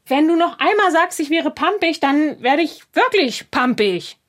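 high, heated threat; irritated warning. Acted. Threatens to get really snippy.

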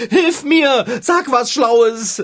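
high, loud, desperate cry for help; panicked plea. Acted. Panicked plea for help before exam.

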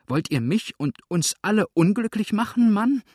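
high, loud, climbing panic; horrified, rising accusation; frightened outcry. A narrator's reading. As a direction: build to a shrieked appeal. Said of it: "rief Misses Bridleford erschreckt".

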